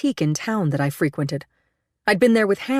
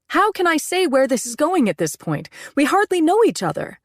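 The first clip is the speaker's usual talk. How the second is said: exasperated protest; 11 semitones higher than usual. A narrator's reading. Rising argument.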